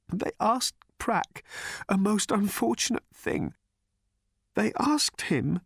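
high, slow, weepy delivery; weeping whisper. A narrator's reading. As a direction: falter through it tearfully, pitched high. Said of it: "he said in a weeping whisper".

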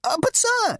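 very high squeal; squealing insect. Acted. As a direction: squeal it in panic. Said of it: "it squealed".

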